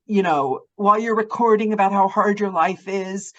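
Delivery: usual speaking voice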